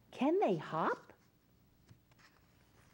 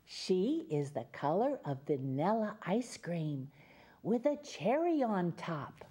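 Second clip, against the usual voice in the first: put-on reading to children; 2 semitones lower than usual. Reads picture book to children.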